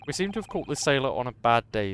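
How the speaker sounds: usual speaking voice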